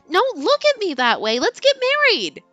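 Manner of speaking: put-on high, pushy teen girl; high girly voice. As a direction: insist with cheeky cheer. Heroine voiced in read story.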